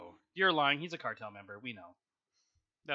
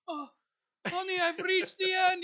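high, slow, loud triumphant mock cry; mock movie hero; 13 dB louder, slower than usual. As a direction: ham it up like a hero reaching the goal. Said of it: "the opening credits of a guy".

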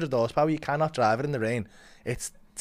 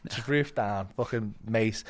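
usual speaking voice

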